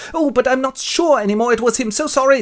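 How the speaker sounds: high-pitched apologetic voice; doubtful old gentleman. Acted. Gentleman withdraws his accusation, high and apologetic. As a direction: back down in a high, fussy apology.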